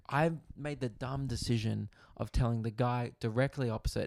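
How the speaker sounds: usual speaking voice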